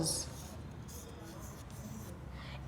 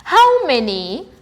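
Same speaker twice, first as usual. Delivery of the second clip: reading the question aloud; slower than usual. Shown as a demonstration. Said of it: "They ask us".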